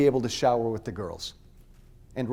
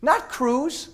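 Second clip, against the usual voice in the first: high, loud, angry yelling; furious shout; 9 semitones higher, 10 dB louder than usual. Real, not acted. Speaker shouting at the crowd about the Republican Party, furious. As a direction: rail against the party at full voice.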